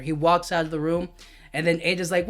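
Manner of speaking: normal talking voice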